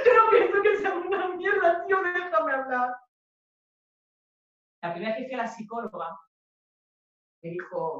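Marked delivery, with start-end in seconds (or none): 0.1-3.0 high, loud pleading; raised, high-pitched desperate protest; desperate louder denial. Acted. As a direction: insist over the other person, loudly.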